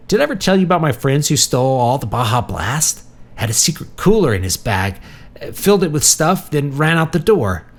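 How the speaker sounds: high-pitched, eager storytelling; excited rambling. A narrator's reading. Marty telling visitors about a drink heist, excited.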